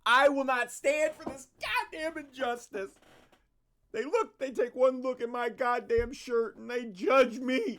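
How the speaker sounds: loud, high mock protest yell; hammed-up fury over a shirt; mock outraged rant. Streamer mocking a rant as a loud, high, hammed-up protest.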